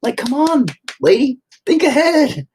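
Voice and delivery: patient scolding therapist, increasingly exasperated; exasperated explaining. Retold or imitated. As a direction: lecture the therapist, building to exasperation. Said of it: "And you're like".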